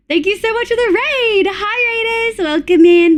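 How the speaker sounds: high, bright thanks to the raiders; excited raid welcome. Real, not acted. Streamer thanking incoming raiders, high and excited. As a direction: greet the raid with a burst of joy.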